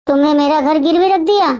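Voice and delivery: ordinary delivery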